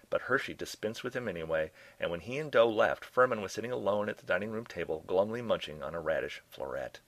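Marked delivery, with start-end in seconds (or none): none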